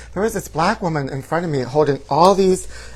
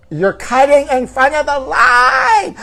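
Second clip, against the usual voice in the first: high, slow furious shout; screamed; 10 semitones higher, 7 dB louder, slower than usual. Retold or imitated. Yelling at a line-cutter, slow furious shout. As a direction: scream it at the old man who cut in. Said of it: "And I screamed".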